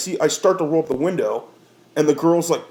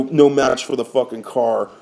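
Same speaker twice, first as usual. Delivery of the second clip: normal talking voice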